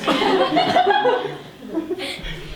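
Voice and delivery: cracking up at his own joke; loud high laugh. Real, not acted.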